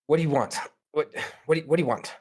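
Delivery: flustered, stumbling nervous speech. Acted. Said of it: "back to that nervous kind of".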